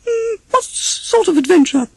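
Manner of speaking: very high, worried, near tears; nervous Piglet. Acted. Piglet fearing an adventure, high and worried. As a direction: ask with rising dread.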